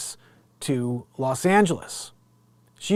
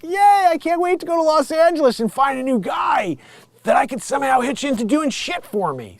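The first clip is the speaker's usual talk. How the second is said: mock gleeful woman; 9 semitones higher than usual. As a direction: gush like a selfish party girl. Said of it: "she's like".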